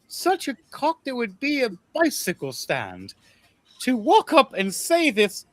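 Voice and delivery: high, breathy-edged royal swoon, rising to a squeal; high swooning queen voice; flustered queen. Acted. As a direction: play the smitten giantess queen, voice climbing.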